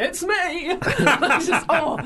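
high sing-out with laughter in it; imitated showy high greeting; mock delighted star. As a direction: announce yourself grandly to imagined fans.

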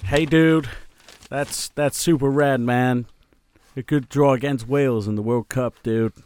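laid-back Californian surfer drawl, slow; Californian accent. Pretend. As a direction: stretch it out like a California dude. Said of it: "Are you being an American?".

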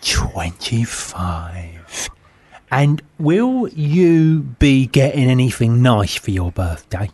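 normal talking voice

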